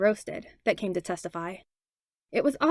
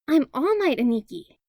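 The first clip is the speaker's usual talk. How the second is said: put-on higher, bright child voice; a small boy proudly picking All Might; higher eager kid voice; excited little kid; 6 semitones higher, 3 dB louder than usual. A narrator's reading. Kid announcing his hero role.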